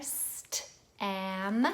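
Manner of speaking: "St, am" sound by sound, stretched. Shown as a demonstration. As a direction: hiss the s, tap the t, draw out "am". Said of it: "all the sounds in there".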